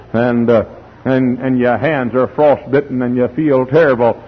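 ordinary delivery

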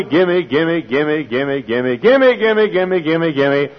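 rapid sing-song string of "gimme"; hammed-up greedy pleading; mock begging. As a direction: imitate a pestering, repetitive plea. Mocking a preacher's caricature of prayer.

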